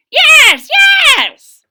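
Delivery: loud, high shout of yes; crying out. Acted. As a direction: yell it while jumping up and down. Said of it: "cried the old man".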